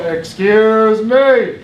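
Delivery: a slow, loud, drawn-out call; real loud. Shown as a demonstration. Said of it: "real loud".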